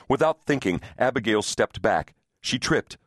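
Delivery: usual speaking voice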